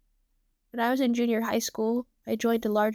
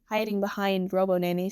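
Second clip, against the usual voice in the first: ordinary delivery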